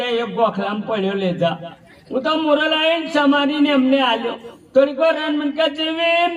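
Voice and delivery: put-on very high, comic old woman's voice throughout; old village wife Jivi. The old wife Jivi tells of serving her husband, in a very high comic voice.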